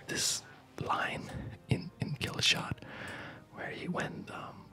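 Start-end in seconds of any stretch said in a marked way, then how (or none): none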